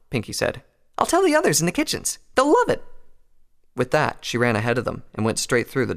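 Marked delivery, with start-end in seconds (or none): 1.0-2.8 very high, bubbly decision; cheerful excitement. A narrator's reading. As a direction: declare it happily in a high voice. Excited Pinkie plans the party.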